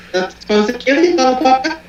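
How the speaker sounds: high mock threat. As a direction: squeak out the tough-guy line as a joke.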